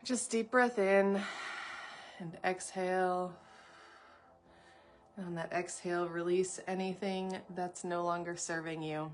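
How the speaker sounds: hushed, unhurried breathing guidance; guided meditation. Real, not acted. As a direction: ease listeners gently into stillness, softly.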